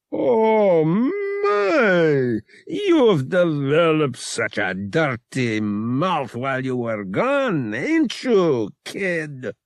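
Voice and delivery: high, mock-scandalized exclamation; mocking shocked crook. A narrator's reading. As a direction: feign shock, then tease him slowly. Shrike mocks Han's cursing.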